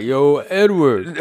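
put-on lazy Philly boxer accent, slow and loud; Rocky accent. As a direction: stretch it out like Rocky calling his buddy. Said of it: "in that Rocky accent".

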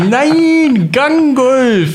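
very high, loud Saxon correction; irritated Saxon caller. Retold or imitated. Annoyed caller correcting the misheard firm name in Saxon. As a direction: snap the name back in exasperated broad Saxon. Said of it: "dann hast du so richtig gehört".

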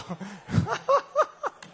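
sudden high laugh; high burst of laughter. Real, not acted. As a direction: laugh it off at the crowd. Laughs after rallying the crowd.

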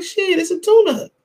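mock clueless fans. Commentator quoting people who don't know no better, mocking high voice. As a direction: imitate know-nothings in a high, dismissive voice. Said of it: "people who don't know no better, like".

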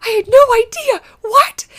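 high, loud, startled cry of surprise; shocked disbelief. Retold or imitated. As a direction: yelp it like the news floored you. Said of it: "I'm like".

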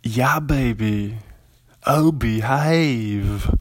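slow, drawn-out mock British playboy; playful drawn-out impression of a groovy Englishman; Austin Powers. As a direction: imitate a hammed-up British catchphrase, stretching the words. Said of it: "He always does the Austin Powers stuff".

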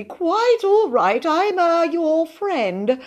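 high, hesitant humouring tone; nervous high-pitched coaxing; anxiously soothing. A narrator's reading. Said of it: "he said soothingly".

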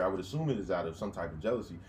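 ordinary talk

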